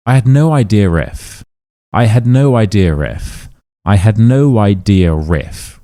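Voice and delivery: "idea if" as idea-riff, R inserted; intrusive R. Shown as a demonstration. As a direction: sound an R between "idea" and "if". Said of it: "we are adding this R sound in".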